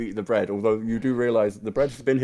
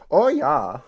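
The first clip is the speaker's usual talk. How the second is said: put-on higher, louder German-accented villager; townswoman's German accent; 6 semitones higher, 7 dB louder than usual. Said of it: "when I hear her accent".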